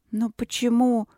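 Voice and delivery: plaintive drawl. A narrator's reading. Princess pleading with the novice, drawn-out and plaintive. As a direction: whine it a little, like a begging girl. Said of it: "протянула Каролина жалобно".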